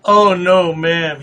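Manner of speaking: usual speaking voice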